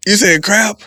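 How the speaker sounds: high loud disbelieving what; high loud amazed question; incredulous surprise. Retold or imitated. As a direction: burst out in shocked relief. Relieved surprise at answer.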